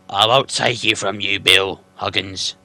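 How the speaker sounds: loud, slow mocking troll; troll Bert insulting. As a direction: jeer at William slowly.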